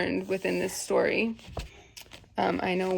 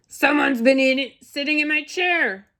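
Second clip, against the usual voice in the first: loud, raised, indignant bear; big forceful storybook bear, pitched up; great huge Papa Bear voice; 5 semitones higher, 6 dB louder than usual. A narrator's reading. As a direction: boom it out like an outraged Papa Bear. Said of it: "in his great, huge voice".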